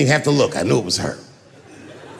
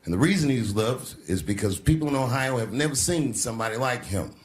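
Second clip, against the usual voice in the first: ordinary delivery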